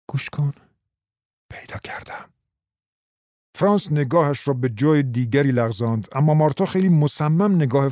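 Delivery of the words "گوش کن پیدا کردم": dropping to a whisper; lowered voice. Acted. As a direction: share a secret, hushed. (با صدای پایین و نجوا)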